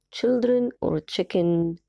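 put-on "Children or chicken" read very slowly; slow choice. Shown as a demonstration. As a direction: spell out the choice slowly for the class. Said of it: "Circle the correct word". (قراءة الاختيار ببطء شديد)

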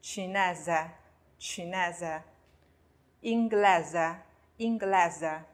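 chinese, inglese ending in buzzed -ze; very slow Italian chinese, inglese with voiced s. Shown as a demonstration. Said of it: "那么发z的音".

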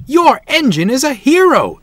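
high, loud cheer; excited shout. A narrator's reading. Rescued passengers to the station master, excited shouting. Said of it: "they shouted".

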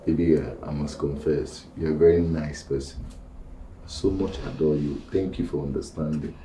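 low, soft and unhurried; hushed, adoring reassurance; tender confession. Acted. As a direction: speak softly and low, with deep affection. Tender confession of adoration to partner.

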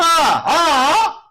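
loud high cry of dismay; anguished shout. Real, not acted. Streamer shouting as the upgrade breaks. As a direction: yell at the failure. Roll fails, outburst.

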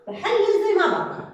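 emphatic rule. Shown as a demonstration. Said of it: "teacher rule stated loudly".

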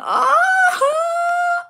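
high-pitched squealing oh of delight; excited squeal. Real, not acted. Opening a fan's gift on camera, a high excited squeal. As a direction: squeal it out like you just saw something adorable.

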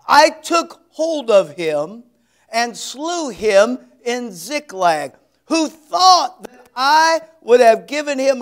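slow, loud solemn preaching of the verse; scripture reading. Acted. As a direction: proclaim it slowly like holy writ. Reads scripture slowly, loud.

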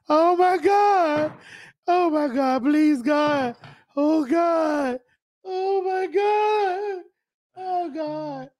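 high, slow, crying laughter; hysterical laugh. Real, not acted. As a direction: beg through tears of laughter. Laughing fit at video.

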